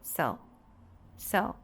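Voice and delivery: "so" as a short, weak səl; brief unstressed "so", the end of pencil; unstressed schwa. Shown as a demonstration. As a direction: drop "so" low with a reduced vowel. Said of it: "a sílaba átona".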